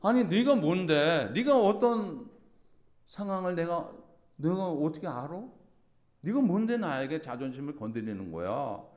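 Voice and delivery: high, loud, slow offended retort; indignant outburst. Retold or imitated. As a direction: snap back with wounded pride. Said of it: "누가 내 자존심 건드리면". (자존심 상해 쏘아붙이는 말투)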